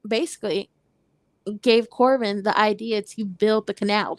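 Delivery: normal talking voice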